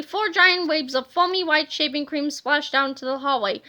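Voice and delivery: usual speaking voice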